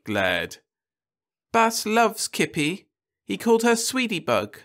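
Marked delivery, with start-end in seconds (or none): 1.5-4.6 moon over your TV idol; dreamy girl. A narrator's reading. Daughter dreamily quoting idol.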